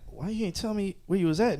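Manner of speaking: hushed, lightly put-on version of her question; imitating her voice. Reading a text back as she would, lightly imitated. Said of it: "in hers to be like".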